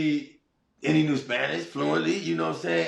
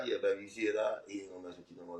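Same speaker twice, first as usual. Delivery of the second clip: soft reassurance; 5 semitones lower, 11 dB quieter, much faster than usual. Retold or imitated.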